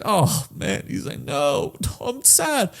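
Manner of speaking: soft mock-sad whine; acted sadness. Reviewer imitating the dog actor, soft and sad. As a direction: play a sad dog, quietly. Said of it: "If he's sad, he's like".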